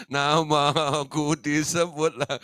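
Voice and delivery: slow, drawn-out mock delight; pleased listener. Preacher voicing a pleased churchgoer, slow mock delight. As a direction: savour hearing your name prayed.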